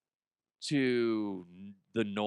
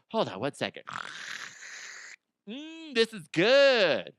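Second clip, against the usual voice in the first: mock savoring; 7 semitones higher than usual. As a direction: relish the mushy food like a treat. Date sipping blended food.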